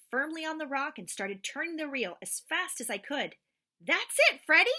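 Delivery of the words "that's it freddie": high, loud cheering yell; dad yelling encouragement at son. Acted. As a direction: cheer the boy on. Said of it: "my dad yelled".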